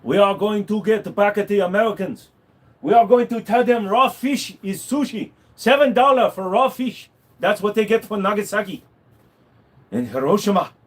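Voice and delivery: hammed-up comedy Japanese voice plotting revenge; exaggerated put-on Japanese accent; mock Japanese accent. Comic Japanese character voice.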